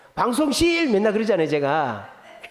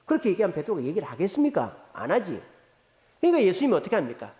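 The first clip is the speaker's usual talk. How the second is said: usual speaking voice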